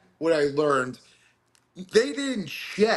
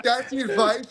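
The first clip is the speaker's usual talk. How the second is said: high-pitched mock indignation; incredulous high quote; imitating irked woman; 8 semitones higher than usual. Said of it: "one of the women who was in the boat".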